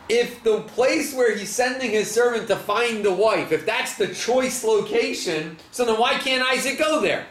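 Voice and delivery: pitched well up, pressing the puzzle; rising disbelief, pushing the contradiction; incredulous questioning. Real, not acted.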